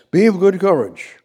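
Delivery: loud, high scripture proclamation; preacher booming the verse; proclaimed. Acted. Preacher calling the verse out to the congregation, loud.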